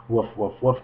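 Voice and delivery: lightly voiced playful barking; mock dog bark.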